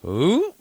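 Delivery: high, loud little "ooh" of a catch; quick "ooh". Retold or imitated. Reaching to catch a falling buddy, high quick "ooh". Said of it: "just happened to just go".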